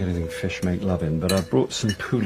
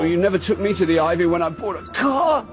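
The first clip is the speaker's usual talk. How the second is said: indignant shout; 8 semitones higher than usual. Acted. Indignant outburst mid-contraction.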